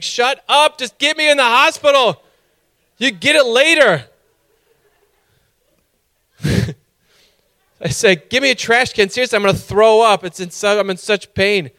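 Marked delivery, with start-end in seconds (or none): 0.0-4.1 loud, high-pitched outburst of desperate impatience; exasperated shouting. Retold or imitated. Patient cutting off hospital intake questions, shouting in exasperation. 8.3-11.7 loud, high, hurried plea from someone in agony; acted-out frantic patient; desperate demanding. Patient begging hospital staff for help, frantic and raised-voiced.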